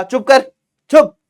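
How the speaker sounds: scolding. Shown as a demonstration. Mother scolds the crying child, loud and sharp. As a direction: bark at the child to shut up. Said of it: "बच्चे को डांट कर क्या कहा".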